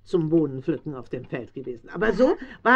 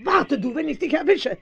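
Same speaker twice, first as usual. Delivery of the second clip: higher, mock-fierce threat; threatening the raven; 8 semitones higher than usual. Farmer's wife threatening the raven with the cooking pot, higher. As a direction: shake a fist at the bird with your voice.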